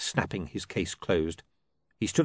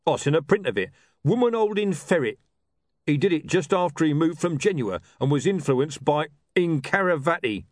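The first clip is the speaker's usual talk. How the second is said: louder, higher enthusing street tough; a thug gushing over art; rough thug voice; 9 semitones higher, 6 dB louder than usual. A narrator's reading.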